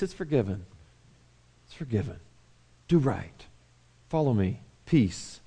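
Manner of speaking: soft, low, tender comfort; gentle hushed reassurance. Acted. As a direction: murmur it like forgiveness to a child.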